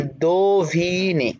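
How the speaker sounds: "দোভিনে" slowly broken into do... vi... ne; ওকার উচ্চারণ. Shown as a demonstration. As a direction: hold the o and i of "দোভিনে". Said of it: "ওকার বললাম". ("দোভিনে" ধীরে, syllable ভেঙে)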